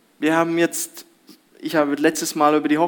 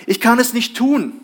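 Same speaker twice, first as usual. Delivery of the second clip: high, loud protest; 8 semitones higher, 9 dB louder than usual. Pretend. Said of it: "Wir sagen".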